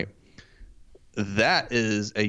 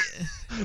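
breathy laugh; 10 semitones higher, 9 dB quieter than usual. Real, not acted. Reacting to outburst.

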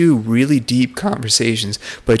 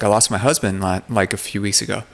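ordinary delivery

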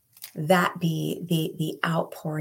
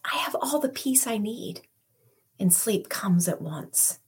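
whispered prayer; 2 semitones higher, 4 dB quieter than usual. Pretend. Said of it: "let's be those who can say".

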